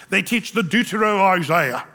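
slow, lightly put-on British accent to sound learned; a teasing British accent, as if a professor; mock British accent. Pastor mocking liberal professors in a light British accent. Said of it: "why do you say it with a British accent".

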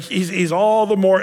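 ordinary talk

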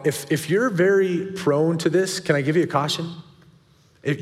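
ordinary talk

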